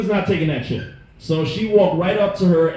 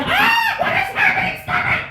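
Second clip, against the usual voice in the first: very high panicked shriek; mock freak-out. Freaking out during fight.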